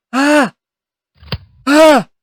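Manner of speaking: high, loud shrieking scream; shocked scream. Real, not acted. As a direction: shriek in horror. Snaring appendix.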